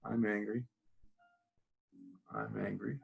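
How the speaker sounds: low, very soft self-talk; hushed, low-pitched repeated phrase, just for yourself; saying it to oneself. Shown as a demonstration. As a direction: murmur it privately to yourself. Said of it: "you're saying it to yourself".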